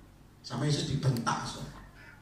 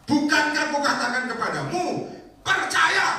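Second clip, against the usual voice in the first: much higher, loud rebuke; Jesus rebuking; 13 semitones higher, 8 dB louder than usual. Retold or imitated. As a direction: snap it at her, rising to a shout. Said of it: "Bentak apa?".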